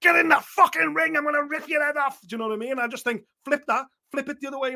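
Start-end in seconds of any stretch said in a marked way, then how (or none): 0.0-2.2 high, loud mock challenge shout; mock angry shout. A pundit voicing Chantel calling Katie into the ring, loud mock shout. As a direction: bark it out like a fighter calling out her rival. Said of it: "Chantel was like".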